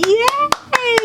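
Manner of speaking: high, quick cheering invitation. Real, not acted. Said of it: "Can you clap with me".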